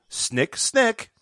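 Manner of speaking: high, snappy scissor sounds; snipping sound effect. Acted. Hands become blades.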